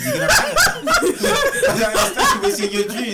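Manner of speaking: high laughing squeal; cracking up mid-sentence. Real, not acted. Laughing while mocking trolls.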